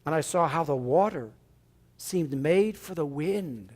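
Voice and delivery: slow reflective reading; reading Emerson aloud. Acted. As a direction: read the journal slowly, savoring it. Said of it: "I want to read something".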